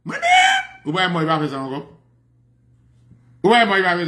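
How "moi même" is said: very high, drawn-out mock wail; mimicked shrill cry. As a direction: wail it out in falsetto. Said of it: "la vini dit". (rèl byen wo pou imite)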